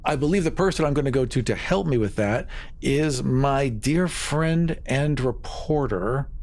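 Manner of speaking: usual speaking voice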